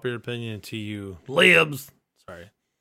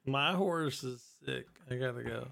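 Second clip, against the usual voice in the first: very slow, softer deadpan take; deadpan Terry Funk; 9 dB quieter than usual. Retold or imitated. Host retelling Terry Funk's exit line, slow and quiet deadpan. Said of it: "the Terry Funk thing".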